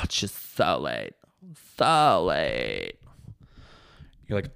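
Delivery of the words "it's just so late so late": low, drawn-out sarcastic groan; mock despair. Partner throwing his lateness warning back, mock despair. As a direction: sink low and stretch it out mockingly.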